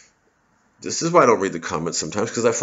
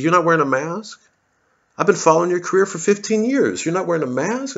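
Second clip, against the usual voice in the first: higher, incredulous fan voice; mocking fan's voice; 8 semitones higher than usual. Comedian mimics a shocked fan questioning his mask. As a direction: play the shocked follower, pitched up. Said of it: "He says".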